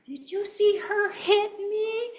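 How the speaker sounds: high, slow, outraged mock yell; yelling neighbor. Neighbor complaining to her partner, imitated high yelling. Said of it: "and she yells".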